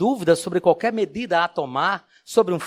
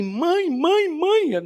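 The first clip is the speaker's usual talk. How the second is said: high, eager call for mom; a small donkey bursting in; excited foal calling mother; little donkey calling; 12 semitones higher, much slower than usual. Acted.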